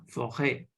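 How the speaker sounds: forêt with an open eh, low and soft; French forêt, said low. Shown as a demonstration. Teacher repeats forêt back to the student. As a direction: model forêt gently in French. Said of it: "this accent is pronounced as".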